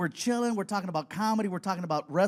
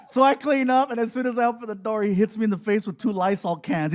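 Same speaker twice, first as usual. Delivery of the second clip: usual speaking voice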